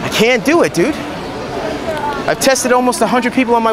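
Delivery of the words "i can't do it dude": ordinary talk, nothing marked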